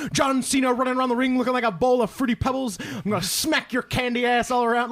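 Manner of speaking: trash talk like a wrestler on the mic; The Rock impression. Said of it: "he's like".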